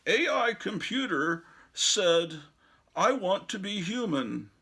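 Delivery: high, slow mock computer voice; robot voice. Mocking the AI computer, high robotic voice. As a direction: play the AI as a stiff machine, slowly.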